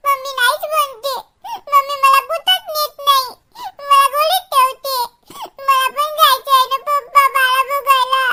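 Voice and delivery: put-on sobbing complaint; tearful whining. Child complaining to Papa about mother, tearful whine. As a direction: whine through tears, milking it for sympathy. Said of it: "रडू नये बा".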